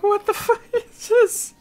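high, slow, laughing what the fuck; hammed-up laughing disgust; mock disbelief. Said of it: "There's some Pokemon you're like".

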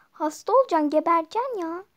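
loud, exasperated scolding; angry yell. Acted. Mother shouting that the ice cream will make her sick.

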